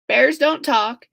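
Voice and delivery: usual speaking voice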